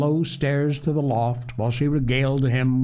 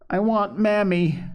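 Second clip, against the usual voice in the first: put-on high, slow little-girl wail; plaintive child's cry; 9 semitones higher than usual. A narrator's reading. Little girl crying for her mother, plaintive. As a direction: whine it pitifully, drawn out. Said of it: "cried poor Phronsie".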